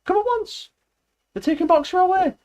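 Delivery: very high, loud desperate call; alarmed shout. A narrator's reading. Benjamin warning that Boxer is being taken, desperate shout. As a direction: scream the warning across the field. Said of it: "he shouted".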